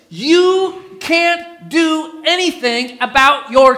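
stretched out, each word weighted; slow emphatic point. Acted. Preacher driving home the point to his congregation, slow and weighty. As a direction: lay the words down slowly for the congregation.

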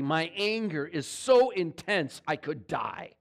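slow and weighty, leaning hard on so; emphatic Jonah. Retold or imitated. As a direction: drag out so as if it hurts.

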